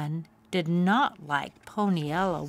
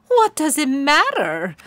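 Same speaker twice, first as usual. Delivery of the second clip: much higher and louder mocking sneer; 8 semitones higher, 9 dB louder than usual. A narrator's reading. Pony sneering at Poniella's question, loud sneer. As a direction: jeer at the question. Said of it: "Bunbun sneered".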